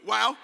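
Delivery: comic "wow" of pain; mock yelp. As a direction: pretend poke in the nose. Repeats poked nose reaction.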